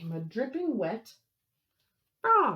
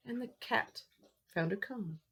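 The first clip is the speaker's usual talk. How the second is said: ordinary delivery, nothing marked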